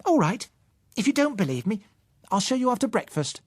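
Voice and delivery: put-on a child announcing a challenge; huffy girl. Offended Susan daring Bill to watch, high huffy child voice. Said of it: "she announced".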